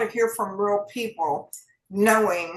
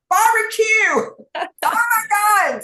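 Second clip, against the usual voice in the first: high, loud, slow, drawn-out delight; swooning over food; 11 semitones higher, 6 dB louder, slower than usual. Real, not acted. Host naming favourite foods, swooning high and slow. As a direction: savour each word like a craving.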